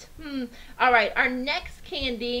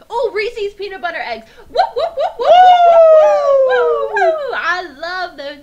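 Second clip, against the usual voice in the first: very loud, high whoop chant; ecstatic whooping; 12 semitones higher, 15 dB louder, slower than usual. Real, not acted. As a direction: whoop it out with laughter. Favorite candy cheer.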